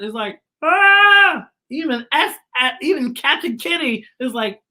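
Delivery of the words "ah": high, loud startled ah; shocked gasp. A narrator's reading. Teacher voicing Z, shocked at finding Beatrice. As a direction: jump back in shock. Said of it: "Z is shocked".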